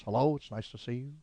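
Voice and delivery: softly mumbled, unenthusiastic greeting; Albert Brooks. Acted. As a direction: do his glum, subdued hello. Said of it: "I actually do an impression of him".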